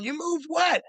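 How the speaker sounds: high, incredulous question; astonished mock outcry; roommates startled awake. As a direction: ask it in disbelief, voice shooting up. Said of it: "they wake up".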